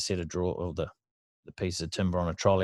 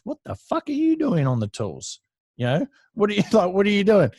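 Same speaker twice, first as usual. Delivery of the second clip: factory boss catches the apprentice on the tools, incredulous and higher; mock outraged boss; 11 semitones higher, 4 dB louder than usual. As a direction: throw the question at him, half laughing.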